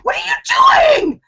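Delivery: very high, loud screamed question; retold scream. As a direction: scream it in alarmed outrage. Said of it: "and I scream".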